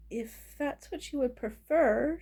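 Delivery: slow, careful reply. A narrator's reading. Goblin cook cautiously drops the act, speaking slowly. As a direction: say it slowly and guardedly. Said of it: "she said slowly".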